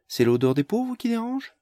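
high, sneering mock. Mélise mocking Barnes about the poor, sneering. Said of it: "railla Mélise".

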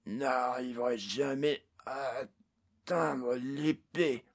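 slow, rasping character voice; hoarse murmur. A narrator's reading. Orc slowly regaining his voice, hoarse murmur. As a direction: drag it out in a hoarse rasp. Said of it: "Elle n'était qu'encore qu'un murmure rauque".

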